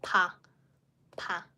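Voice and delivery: pa with the lips bursting open; 把“pa”发成双唇爆开的平常p音; plain pa. Shown as a demonstration. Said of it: "just a pa".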